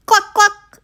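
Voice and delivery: high squeaky hen clucks; high playful clucking; pig clucking like a hen. Acted. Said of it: "said Pig".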